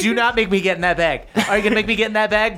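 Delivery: mock-stern, speeding up into the question; exasperated warning. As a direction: warn them like a fed-up minder. Balnor threatens Bastion in bag.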